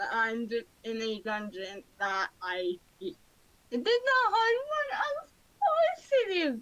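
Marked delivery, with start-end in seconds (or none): 3.7-6.6 high mock whine. Hates the dungeon.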